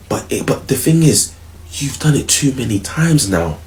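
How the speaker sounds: ordinary delivery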